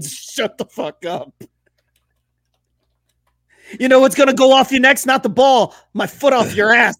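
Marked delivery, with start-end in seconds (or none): 3.8-7.0 loud, fast furious coach threat; mock angry coach. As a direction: bark it at the kid like a raging coach.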